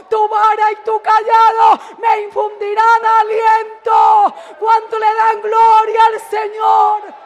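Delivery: loud, high, driving sermon climax; preaching at full force. Acted. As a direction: thunder the promise to the congregation. (predicación a todo pulmón)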